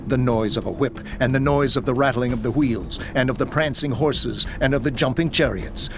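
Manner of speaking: plain reading voice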